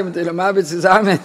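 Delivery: words half laughed out; laughing. Real, not acted. Telling listeners a saying, laughing along. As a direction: laugh through the saying as you quote it.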